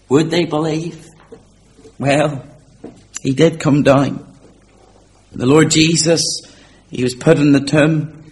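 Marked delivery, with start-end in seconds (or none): none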